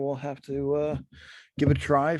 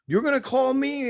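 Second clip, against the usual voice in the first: angry reply; 11 semitones higher than usual. Retold or imitated. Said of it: "they would start getting pissed off".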